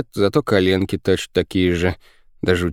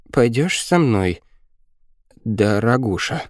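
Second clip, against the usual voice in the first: slow, higher-pitched dutiful come-on; meekly recited, stretched-out invitation; obedient repeating; 4 semitones higher, slower than usual. A narrator's reading. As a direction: parrot a coached line slowly, drawing out each phrase. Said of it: "послушно повторила женщина".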